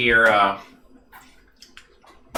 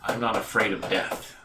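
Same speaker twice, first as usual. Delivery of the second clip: quiet defiance; 2 semitones lower, 10 dB quieter than usual. Acted. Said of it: "He goes".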